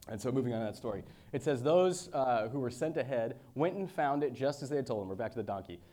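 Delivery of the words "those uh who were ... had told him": raised, louder preaching read; scripture reading. Acted. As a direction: proclaim the passage to the congregation. Reads scripture aloud.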